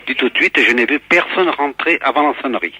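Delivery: normal talking voice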